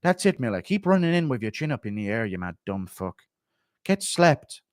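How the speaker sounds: deadpan, subdued corner advice; Kavanaugh impression. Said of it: "Kavanaugh coaching Miller versus Bobby impression".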